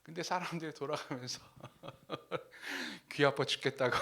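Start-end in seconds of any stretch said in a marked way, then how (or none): 1.6-4.0 laughing through a retold complaint. Real, not acted. Preacher quotes people leaving the prayer meeting, laughing. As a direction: break into chuckles on the quote.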